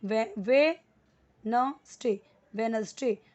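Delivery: वेडनेसडे drawn out slowly, every syllable spelled out; Wednesday said slowly. Shown as a demonstration. Teacher showing learners how to say the day, slowly. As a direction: stretch वेडनेसडे out so learners can copy it. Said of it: "हम इसे क्या बोलेंगे?".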